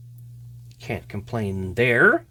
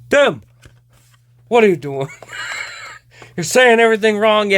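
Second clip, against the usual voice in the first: higher, louder mock scolding of Tim; Don Cherry scolding; 7 semitones higher, 4 dB louder than usual. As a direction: bark at Tim like a pundit calling out a blunder. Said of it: "to have him narrate".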